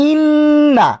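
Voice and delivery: ইন্না with the doubled n held long, pitched high; ghunnah. Shown as a demonstration. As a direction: hold the n of ইন্না in a long chanted hum. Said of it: "অর্থাৎ আমরা গুণনা করছি".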